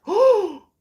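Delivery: high, loud mock gasp; shocked gasp. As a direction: act out scandalized shock. Acts out own shocked reaction.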